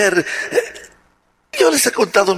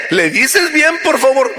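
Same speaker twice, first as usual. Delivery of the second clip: higher, insistent "¿Le dices bien"; pleading daughter; 6 semitones higher than usual. Retold or imitated. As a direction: plead harder, pitch rising on "bien". Daughter begs father again.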